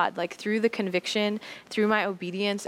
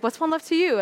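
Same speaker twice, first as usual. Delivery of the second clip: higher and louder question; Cece's voice; 9 semitones higher, 4 dB louder, faster than usual. Retold or imitated. Cece asks her in return, higher voice. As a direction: bounce it back up high.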